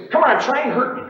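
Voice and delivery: loud, high-pitched taunt; daring challenge. Shown as a demonstration. Trader goading the pit into trading, daring. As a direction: dare them to take you on. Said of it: "something in your voice that says".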